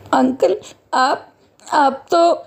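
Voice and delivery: put-on hesitant, halting child character; a hint of a child faltering in recognition; lightly voiced orphan girl. Orphaned girl recognises the guard at her door, hesitant.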